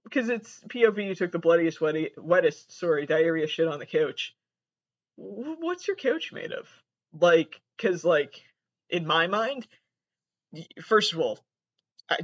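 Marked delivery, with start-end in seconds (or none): 5.2-6.7 incredulous question. Real, not acted.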